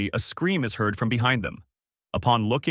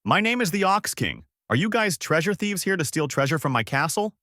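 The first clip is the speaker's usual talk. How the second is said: mild bellow, pitched up; 7 semitones higher than usual. A narrator's reading. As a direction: announce yourself in a raised, higher voice. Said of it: "The man bellowed out".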